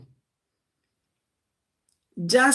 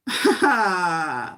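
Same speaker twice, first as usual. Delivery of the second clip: short laugh; 4 semitones lower than usual. Real, not acted. Short laugh after I know.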